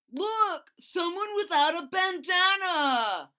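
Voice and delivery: high, loud taunting bully voice; bully's jeering shout. Acted. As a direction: yell it like a big mean dinosaur spotting prey. Bully dinosaur Tyrone voiced.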